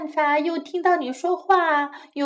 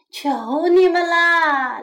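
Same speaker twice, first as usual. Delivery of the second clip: raised, louder plea; calling out; 4 semitones higher, 5 dB louder than usual. A narrator's reading. Mouse begs the crickets, calling out. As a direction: plead loudly with lifted pitch. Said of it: "老鼠叫起来".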